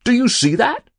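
high, loud eager question; high loud thrill at the trout; excited cry. A narrator's reading. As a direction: point it out, thrilled. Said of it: "cried Mister Castwell".

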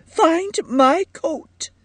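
high, slow tearful demand; distressed wife insisting; insistent. A narrator's reading. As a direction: draw it out in a high, upset voice. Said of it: "insisted Edith".

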